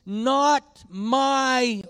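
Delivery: high, loud impassioned pulpit voice; preaching at full force. Acted. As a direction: lift the congregation toward the climax.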